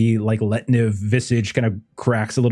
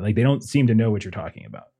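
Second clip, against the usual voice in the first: ordinary talk, nothing marked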